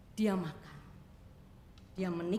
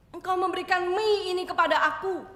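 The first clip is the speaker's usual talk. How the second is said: emotional outburst; 9 semitones higher, 10 dB louder than usual. Acted. Girl thanks owner emotionally.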